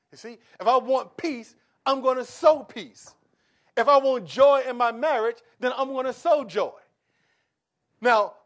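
loud, high, building preacher cadence; full-force preaching. Acted. Preacher calling out to his church, loud and rousing. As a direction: drive each line home to the congregation.

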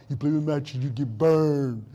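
low bear voice, last words drawn out; Smokey the Bear. Retold or imitated. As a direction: imitate a mascot's deep warning, slowing at the end. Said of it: "that's how you talk".

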